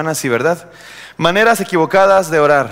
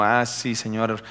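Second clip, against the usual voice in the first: low, soft rote prayer mumbled along; rezo rutinario; 6 semitones lower, 9 dB quieter, slower than usual. Acted. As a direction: recite a classic prayer without conviction. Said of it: "el clásico rezo". (rezo de rutina, bajito y apagado)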